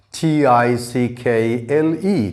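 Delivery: slow, letter by letter; spelling out. Shown as a demonstration. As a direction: spell it out with each letter separate. Spelling a word out.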